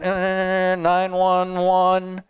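droning stuck "ehhh" into a drawn-out cry; mock panic. Preacher mimicking how many of us would react, mock panic. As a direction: play helpless alarm, stretched on one held note.

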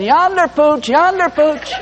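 high sing-out call to a dog; mock dog call. Mock dog call, the literal version.

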